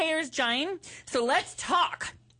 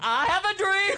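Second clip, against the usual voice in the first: mock orator; 6 semitones higher than usual. Quoting the famous speech.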